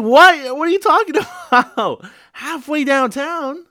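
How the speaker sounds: loud, high-pitched disbelief; incredulous outburst. Retold or imitated. Passenger answers driver going the wrong way, incredulous shout. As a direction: shout it way up high, stretching the end. Acted shocked reply to the driver.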